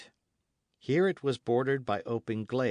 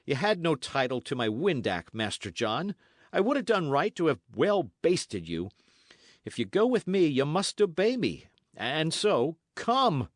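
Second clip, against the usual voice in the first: louder, higher stern orders; stern Dick, louder; Dick, commanding; 5 semitones higher, 4 dB louder than usual. Acted. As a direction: tell him to obey and come. Dick orders Matcham along.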